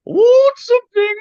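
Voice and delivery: very high, loud mock British opening; British accent. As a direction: launch into a hammed-up posh Brit. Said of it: "I wanted to do it in a British accent".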